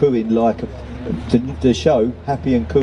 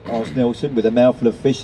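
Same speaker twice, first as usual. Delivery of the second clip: usual speaking voice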